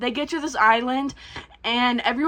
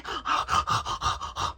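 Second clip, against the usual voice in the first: unvoiced gobbling gasps; noisy mock chomping breaths; imitated messy eating; 6 dB quieter than usual. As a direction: imitate men slobbering over their food. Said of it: "dribbling food everywhere, going".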